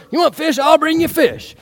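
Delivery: high-pitched, over-keen volunteering; eager offer. Acted. Eager Peter answers Jesus.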